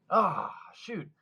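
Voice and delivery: high, quick and determined; a kid rushing back out for the record; excited "I'm gonna go do it"; eager resolve. Retold or imitated. Learning of world record.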